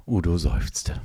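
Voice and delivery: ordinary delivery, nothing marked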